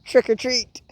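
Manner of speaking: high, loud taunting Goblin shout; villain shout. A narrator's reading. Green Goblin taunting Spider-Man as he throws bombs, high loud shout. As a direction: fling it out like a cackling villain. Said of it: "shouted the Green Goblin".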